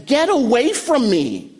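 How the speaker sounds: high-pitched mock "keep back"; acted defensive recoil. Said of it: "to recoil in protection and defense".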